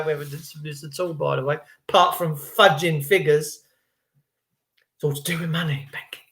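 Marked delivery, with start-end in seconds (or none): 5.0-6.2 secret whisper; hushed conspiratorial. Real, not acted. Confides the secret.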